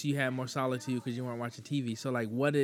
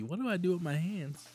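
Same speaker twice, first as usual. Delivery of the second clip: lightly voiced flustered racer; higher, baffled Talladega Nights line; Ricky Bobby impression; 6 semitones higher than usual. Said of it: "Like Ricky Bobby".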